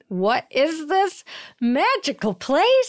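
high, slow, wide-eyed wonder; awestruck wonder. Retold or imitated. Arriving in Portland, voicing dazzled awe. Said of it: "and I was like".

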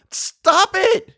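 very high, loud scream; shout. A narrator's reading. Eleanor finally yelling at the voices, desperate shout. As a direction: burst out screaming to end it. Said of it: "she shouted".

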